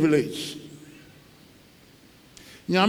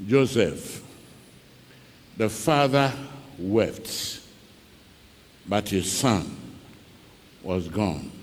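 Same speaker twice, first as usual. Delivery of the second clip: much lower and softer, almost breaking off; hushed grief; 10 semitones lower, 9 dB quieter than usual. A narrator's reading. Preacher tells the congregation of the father's loss, hushed. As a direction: let the sorrow sink the voice. Sad climax of the story.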